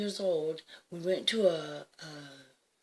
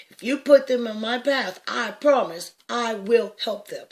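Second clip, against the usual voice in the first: higher, louder pleading vow to God; fervent promise cried out to God; desperate prayer; 6 semitones higher, 9 dB louder than usual. Retold or imitated. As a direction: beg God with everything you have. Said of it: "I start talking to God just immediately".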